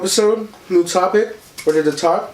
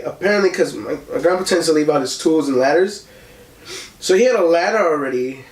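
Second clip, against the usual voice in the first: usual speaking voice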